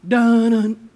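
low, loud "dun-dun" like a movie sting; mock dramatic sting. Preacher mocking doom before the verse, low and loud. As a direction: hit it like an ominous drumbeat.

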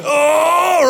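loud, high cry of dismay; panicked "oh". Retold or imitated. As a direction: let out a loud, drawn-out "oh" of dread. Acting out the sinking panic moment.

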